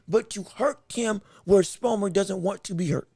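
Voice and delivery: ordinary talk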